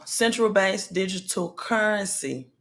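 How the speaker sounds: usual speaking voice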